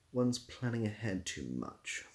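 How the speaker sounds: normal talking voice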